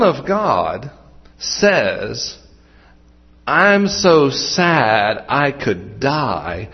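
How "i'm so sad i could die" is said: very slow, drawn-out solemn words; grave, weighty quote. Retold or imitated. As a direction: give each word heavy, deliberate weight. Son of God quoted in earnest.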